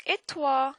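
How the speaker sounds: "Et toi?" high and punched as a question; French question intonation. Shown as a demonstration. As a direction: make the question in "Et toi?" really audible. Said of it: "notice how when we have an intonation in French when it comes to questions".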